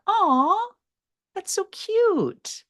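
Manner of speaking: "Aw, that's so cute" swooped way up high and slow; very high, slow, cooing "that's so cute"; high-pitched aw. Shown as a demonstration. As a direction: coo it up in the top of your voice like at a puppy. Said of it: "You can think about saying something like".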